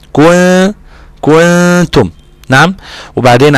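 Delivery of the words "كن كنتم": high, drawn-out nasal hum on the noon of "كنتم"; hidden noon. Shown as a demonstration. Teacher showing noon hidden before ta, long hummed noon in "كنتم". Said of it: "إخفاء النون الساكنة مع التاء".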